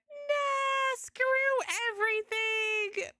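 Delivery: high, slow mock wail of a broken program; hammed-up crying computer voice; mock OBS voice. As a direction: whine it out like the software is sobbing. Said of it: "And O B S is like".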